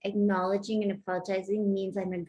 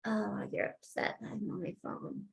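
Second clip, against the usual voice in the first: much softer, sincere; genuine reflection; 11 dB quieter than usual. Shown as a demonstration. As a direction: reflect it back gently and honestly. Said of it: "if you genuinely reflect that back".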